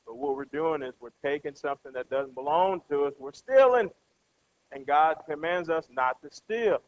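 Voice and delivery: normal talking voice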